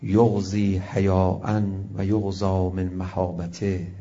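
low, slow Arabic recitation; slow recited verse. Acted. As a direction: reverent chant.

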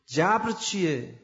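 loud, slow-started forceful sermon; preaching at full force. Acted. Preacher drives the lesson on fate home, loud and forceful. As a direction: hammer the point home to the crowd.

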